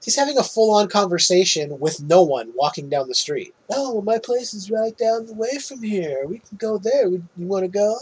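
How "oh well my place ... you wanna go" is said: high lightly charming invitation; smooth it over to a date; lightly voiced suave man; mock charming. Peter talking to imagined woman.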